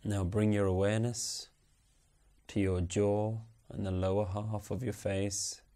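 ordinary talk, nothing marked

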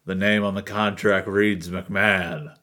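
ordinary delivery

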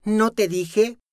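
high, taunting question; defiant. Acted. The girl challenging Luzma, defiant. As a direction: dare her to deny it. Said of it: "preguntó la muchacha desafiante".

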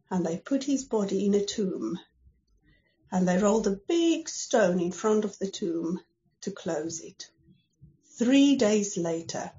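ordinary delivery

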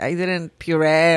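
normal talking voice

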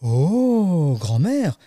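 high, swooping amazed "oh"; drawn-out storybook astonishment; exclaiming little girl. Acted. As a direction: marvel like a wide-eyed child. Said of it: "s'exclame le petit chaperon rouge".

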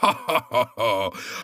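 hearty acted laugh; Santa's laugh. A narrator's reading. Santa bursting out laughing at the hot-air balloon. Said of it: "Babbo Natale scoppiò a ridere".

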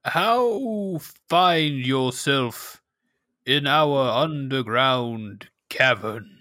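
slow, odd villager greeting; cave dweller voice. Acted. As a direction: greet the stranger warily. GM voices Tunach.

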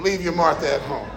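quiet echo. Shown as a demonstration.

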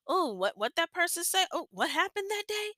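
high-pitched nosy curiosity; imitating a nosy listener, pitch climbing high; eager for gossip. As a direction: perk up, hungry for the juicy story. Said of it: "my ears be tickling sometimes".

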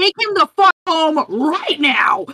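turning from the polite apology to snap at the henchmen; mock angry order. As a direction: bark the order like a boss losing it. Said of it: "turns back and is like".

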